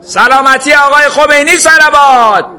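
high, loud full-voiced shout; shouted rallying call. A narrator's reading. Someone in the dark mosque calling for salawat, shouting. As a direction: yell it out to a whole crowd. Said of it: "در تاریکی کسی فریاد زد".

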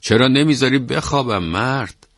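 rising, bewildered query; curious, sleepy question. A narrator's reading. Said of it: "با کنجکاوی پرسید".

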